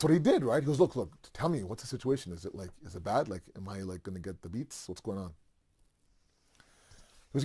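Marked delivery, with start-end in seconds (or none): 0.8-5.3 nervous bird begging for news in a hushed voice; worried hushed asking. Retold or imitated. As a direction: lower and softer, fearing trouble. Hudhud asks anxiously about punishment.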